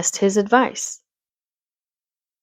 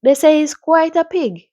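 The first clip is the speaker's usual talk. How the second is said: put-on high-pitched, playful Caribbean accent; 9 semitones higher, 5 dB louder than usual. Doctor remarking on the famous pig, Caribbean accent. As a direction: lift it up in a lilting Caribbean accent. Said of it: "This accent is so funny to me".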